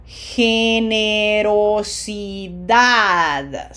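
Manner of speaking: "Generosidad" drawn out syllable by syllable, stressed "-dad"; very slow demonstration. Shown as a demonstration. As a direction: stretch every syllable of "Generosidad", smiling on the i. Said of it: "Say it as slowly as I do".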